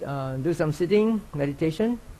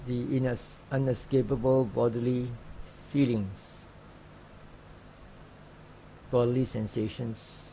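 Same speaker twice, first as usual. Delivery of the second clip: calm, even meditation guide with long pauses; unhurried instructions, leaving long silences between phrases; guided meditation; 7 dB quieter than usual. Real, not acted. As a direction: gently guide sitters into open awareness. Leads a sitting meditation.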